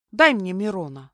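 ordinary delivery

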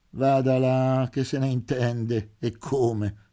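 mocking jab at the doctor's colleague; sneering. A narrator's reading. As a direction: drag the words out with a smirk. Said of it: "sogghignò De Lilière".

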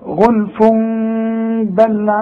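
غلف بل linked by a long hummed m; joined with iqlab. Shown as a demonstration. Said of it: "وعند الوصل عاوز اوصل". (وصل «غلف بل» بإقلاب وغنة)

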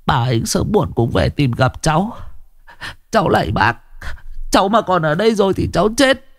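high, rushing, near tears; panicked plea. A narrator's reading. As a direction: beg in rising panic. Tuất's frantic plea. (van xin hoảng hốt)

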